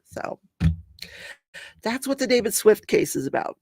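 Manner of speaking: ordinary delivery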